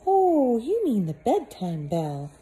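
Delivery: high put-on motherly voice; cheery housekeeper. Missus Molasses sending Peter to bed, high cheery voice. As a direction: shoo him off to bed brightly.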